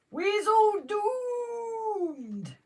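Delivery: long, high, drawn-out doom wail; Dad's Army doom wail. Retold or imitated. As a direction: stretch it out like Private Frazer. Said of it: "That's what Jason said".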